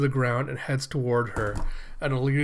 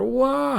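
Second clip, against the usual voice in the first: high yelp; 13 semitones higher, 4 dB louder than usual. Retold or imitated. Sound heard from the dog.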